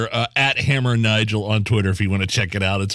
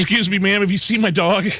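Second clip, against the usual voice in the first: higher, polite mock voice of a man asking; polite stranger; 9 semitones higher than usual. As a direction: play the harmless guy asking nicely. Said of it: "That's probably what he was trying to ask her".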